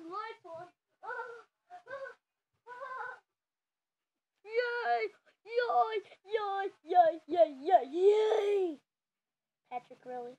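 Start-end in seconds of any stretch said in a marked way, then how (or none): 4.4-8.8 loud, repeated yays of a thrilled toy; excited cheering. Pretend. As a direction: cheer each yay at full voice.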